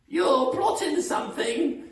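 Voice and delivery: loud, very high suspicious screech; Missus Twit. Acted. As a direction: shriek the accusation in a screechy falsetto. Voicing Missus Twit in a story.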